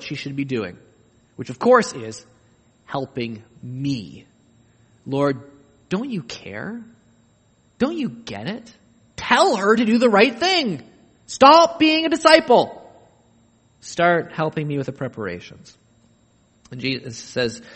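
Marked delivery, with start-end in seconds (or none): 5.1-15.7 building higher and louder, demanding; indignant Martha. Retold or imitated. Martha scolds Jesus about her sister, rising to louder. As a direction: work up from pleading to a demand.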